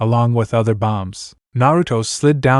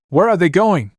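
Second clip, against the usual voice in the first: high-pitched, baffled outburst held back; confused, angry yell; 6 semitones higher than usual. A narrator's reading. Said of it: "Shin yelled in confusion and anger".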